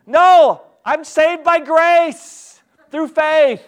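high, loud, drawn-out mock protest; stubborn refusal. Person in the pit refuses the rope, high stubborn holler. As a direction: holler the refusal, stretching the words out.

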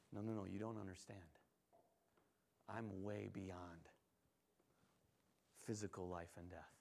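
very soft and low, almost murmured; hushed, tender correction; gentle reassurance. Acted. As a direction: reassure quietly from a place of calm authority. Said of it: "so sensitive, so insightful".

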